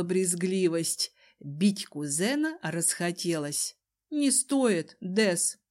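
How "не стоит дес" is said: usual speaking voice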